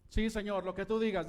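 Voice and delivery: loud, eager yes to the Lord; eager Moses. Acted. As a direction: shout it out, eager to please. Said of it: "dijo Moisés".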